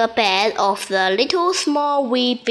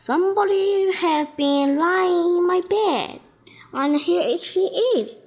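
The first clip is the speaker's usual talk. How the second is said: higher little bear, half laughing; little wee bear voice; 6 semitones higher than usual. A narrator's reading. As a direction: squeak it like a tiny cub. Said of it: "in his little small wee voice".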